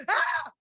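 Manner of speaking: high, loud gasp of horror; a sudden shocked high ah; mock gasp of shock. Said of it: "I don't wanna go".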